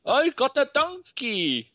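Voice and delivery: high, drawn-out mock Scottish; bad Scottish accent. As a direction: put on a hammed-up Scottish accent. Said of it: "That's my Scottish".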